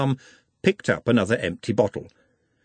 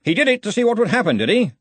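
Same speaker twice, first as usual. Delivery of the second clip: quick, high-pitched cheery query; cheerful; 13 semitones higher, 3 dB louder, faster than usual. A narrator's reading. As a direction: ask it brightly and briskly. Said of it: "he said cheerfully".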